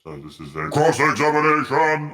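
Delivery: announcer voice. Pretend. Announcer voice continues.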